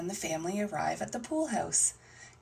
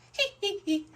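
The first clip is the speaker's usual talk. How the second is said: acted little-child giggle; tittering "hee hee" up high; high-pitched giggle; 12 semitones higher than usual. Voices Peppa giggling.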